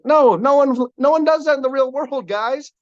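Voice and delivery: high, loud, incredulous protest; emphatic objection. Real, not acted. Coach rejecting all-logic judging to the crowd, emphatic objection. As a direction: push back hard, pitch jumping way up.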